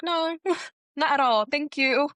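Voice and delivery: high-pitched refusal with a laugh; amused polite refusal. Retold or imitated. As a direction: retell turning it down sweetly, laughing. Amused refusal of offered earplugs.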